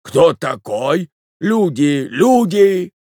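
slow question rising to a high, loud call; alarmed old man's cry; old man shouting. A narrator's reading. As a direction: call for the servants, building to a shout. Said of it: "закричал старик".

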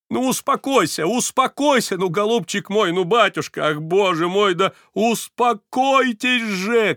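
high, loud frantic calming; frenzied shout. A narrator's reading. Varvara frantically shouts at weeping Stepan to calm down. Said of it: "крикнула она неистово".

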